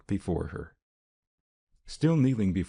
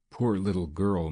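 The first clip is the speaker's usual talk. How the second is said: ordinary delivery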